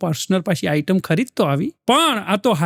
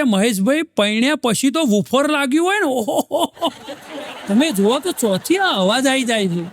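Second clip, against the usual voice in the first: friend's voice; 5 semitones higher than usual. Retold or imitated. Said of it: "મને કે".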